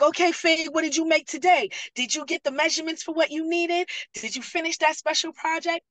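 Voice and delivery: high, insistent questions imitating the group; playful nagging. The other ladies chasing Fig for daily progress, playful nagging.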